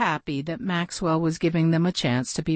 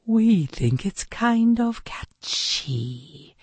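slow, lightly hissed snake voice; a hint of a hypnotic serpent; 4 semitones higher, slower than usual. A narrator's reading. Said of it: "hissed the snakes".

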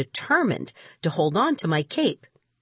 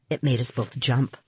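ordinary delivery, nothing marked

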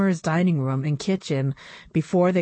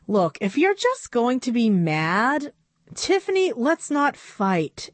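lightly voiced girl; 6 semitones higher than usual. Quarrel between two doll girls.

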